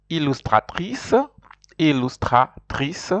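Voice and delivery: "Illustratrice" slowed, with a clear French R. Shown as a demonstration. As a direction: stress the R in "Illustratrice". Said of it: "R's are important".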